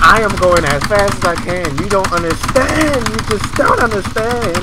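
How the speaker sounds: loud frustrated insistence; fed-up loud protest; exasperated loud. Real, not acted. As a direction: plead angrily to the chat. Defending to chat, exasperated.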